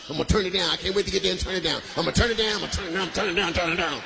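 low, smug, eager to say no; a committee member itching to reject you; gloating committee member. Acted. Mocking a churchgoer on the committee, voiced low and smug.

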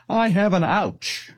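put-on small-child whine, drawn out; mock tearful child. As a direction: lightly imitate a little kid's pitiful complaint. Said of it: "he's crying crocodile tears".

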